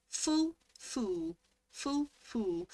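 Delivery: FULL short vowel, FOOL long oo; demonstrating pronunciation. Shown as a demonstration. Testing whether full and fool sound the same, demonstrating pronunciation. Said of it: "How do you pronounce the words full and fool".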